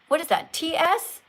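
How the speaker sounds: ordinary delivery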